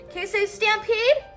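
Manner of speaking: ordinary delivery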